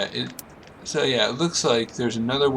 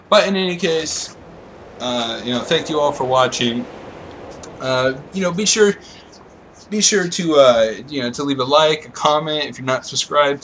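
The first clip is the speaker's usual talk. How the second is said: louder outro sign-off; 2 semitones higher, 5 dB louder than usual. Acted.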